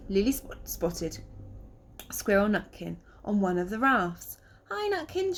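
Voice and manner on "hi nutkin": high friendly call across water. Acted. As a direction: call out to him brightly. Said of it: "she called".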